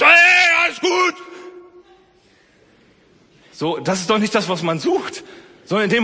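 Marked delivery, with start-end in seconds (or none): 0.0-1.1 loud barked reassurance, much higher; mock yell. Preacher imitating a parent yelling at a scared child. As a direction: bark it like a parent who yells. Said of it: "die mich anbrüllt".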